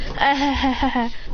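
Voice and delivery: high staccato mock laugh; imitated laugh. Mocking another character's show-off laugh, mock laughing. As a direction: fake a quick "ha-ha-ha".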